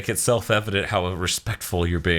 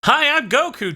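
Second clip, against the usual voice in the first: high, loud cheery hi; high Goku greeting; 4 dB louder than usual. Retold or imitated. Schemmel's Goku in base form, high loud cheery greeting. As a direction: chirp the greeting brightly. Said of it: "you hear Schemmel go through".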